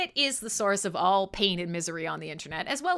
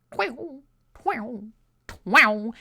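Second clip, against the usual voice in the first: mock twang; much slower than usual. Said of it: "have somebody like".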